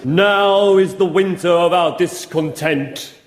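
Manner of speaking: slow, declaiming actor voice; mock-grand Shakespeare delivery; grand Shakespearean recital. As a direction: recite it like the Bard on stage. Shakespeare recited for bingo crowd.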